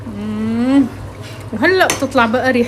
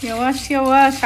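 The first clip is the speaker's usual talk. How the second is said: startled cry; 3 semitones higher than usual. Real, not acted. Pot overflowing, startled cries.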